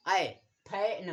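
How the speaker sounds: ऐ then फैन, drawn out slowly; slow model pronunciation. Shown as a demonstration. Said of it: "ए का pronounce क्या हो रहा है".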